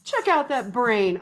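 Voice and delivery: excited praise. Real, not acted. Teacher cheering young learners, excited praise.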